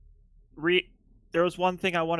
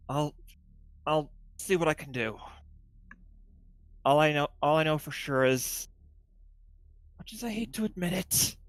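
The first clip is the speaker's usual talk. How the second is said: mildly reluctant, halting admission; understated resigned sigh in the voice; sighing reluctance. Acted. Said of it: "sighs and says".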